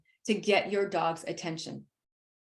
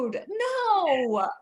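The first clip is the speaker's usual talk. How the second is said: slow, higher, mock alarmed refusal; mock recoil; 7 semitones higher, much slower than usual. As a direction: back off from a stranger, drawn out. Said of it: "You're like".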